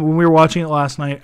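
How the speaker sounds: ordinary delivery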